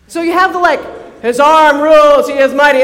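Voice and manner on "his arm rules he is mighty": loud, booming declaration of might; mighty proclamation. Retold or imitated. Preacher proclaiming the mighty king, booming loud. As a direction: boom it out like a herald of a warrior king.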